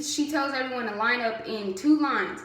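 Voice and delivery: ordinary talk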